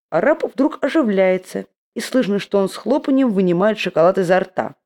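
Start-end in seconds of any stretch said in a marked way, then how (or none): none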